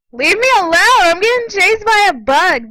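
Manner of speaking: high, loud protest; panicked yelling. Real, not acted. Gamer chased by a bug, panicked yelling. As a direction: yell for it to back off.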